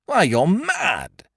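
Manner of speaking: slow, drawn-out shocked shout; he cries out at the vandal. A narrator's reading. Magistrate shouting at Beautrelet smashing statues, shocked shout. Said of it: "shouted Monsieur Filleul".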